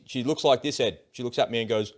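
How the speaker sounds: ordinary talk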